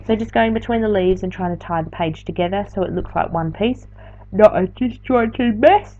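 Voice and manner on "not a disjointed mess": words stretched by a yawn. Real, not acted. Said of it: "it's not a good voiceover on our channel until I yawn".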